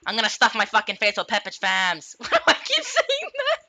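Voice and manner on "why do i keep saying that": high squeaky voice. Pretend. Squeaky voice after Pepperidge line.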